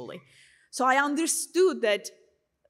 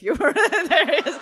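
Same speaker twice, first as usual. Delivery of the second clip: talking through a real laugh, louder and higher; 5 semitones higher, 10 dB louder than usual. Real, not acted. Speaker admits an embarrassing mishap to the audience, laughing. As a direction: crack up while getting the words out.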